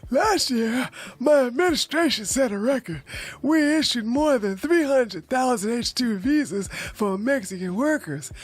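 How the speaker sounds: lightly put-on Biden voice, a little high. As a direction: read the quote as a mock Biden. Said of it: "hold on, let me get my Biden voice".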